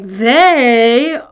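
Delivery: "They" blasted out loud and drawn out; heavy emphasis on They. Shown as a demonstration. Preacher reads verse five with John's emphasis, heavy stress on They. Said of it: "the emphasis that I think".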